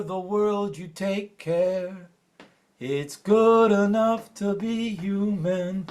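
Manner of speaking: measured, steady spoken-word cadence; spoken-word reading with lifted lines; poem recital. Acted. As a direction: recite it like a poem to a hushed room.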